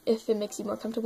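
ordinary talk

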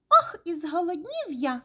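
high, hungry mouse whine; squeaking mouse. A narrator's reading. Hungry mouse at the table, squeaking. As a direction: squeak with an exaggerated sigh of hunger. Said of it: "пищить круть".

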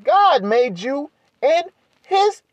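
high, fervent, slowed preaching climax; preaching at full force. Acted. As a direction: drive the point home, voice soaring. Sermon climax, sustained high fervour.

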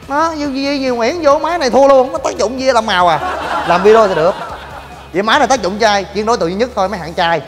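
usual speaking voice

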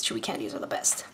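whispered through a candy; whispering. Real, not acted. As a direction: hush it while chewing. Whispered while chewing candy.

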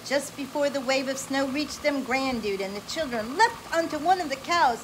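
bright, animated read-aloud voice; lively storybook reading. Acted. Parent reading an adventure story to a child, animated.

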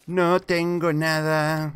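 long exasperated wail of having nothing; drawn-out complaint. Real, not acted. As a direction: drag every word out in protest.

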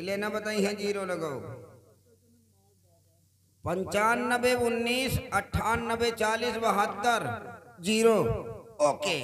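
high eager counting; excited high pitch. Acted. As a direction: call out digits high and loud. Dials number eagerly, loud.

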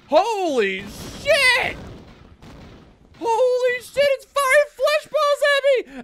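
high, loud screaming alarm; real panic at flesh balls flying; shocked scream. Real, not acted. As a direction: shriek it as the monster fires. Monster attacks the player.

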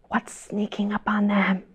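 whispered, hushed question; suspense whisper. Acted. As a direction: lean in and whisper the mystery. Wolf sneaking, reader whispers.

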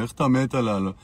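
a hint of a sneering hater; mocking haters. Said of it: "בלב הם אומרים".

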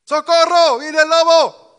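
high, full-volume cry for help; grito de auxilio. Acted. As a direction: shout it out like a shepherd boy faking a wolf alarm. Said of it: "para gritar nuevamente". (grito agudo y fuerte pidiendo socorro)

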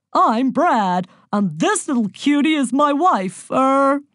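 big jovial American, stalling at the end; booming American accent. A narrator's reading. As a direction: introduce yourself as a brash American husband. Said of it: "in an American accent".

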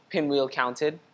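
plain reading voice

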